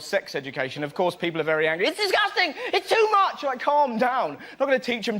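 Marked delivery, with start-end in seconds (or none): 1.9-3.4 mock outrage, squealed very high; outraged parent. Comic mocking outraged parents, high shriek. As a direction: ham up a shrieking angry parent.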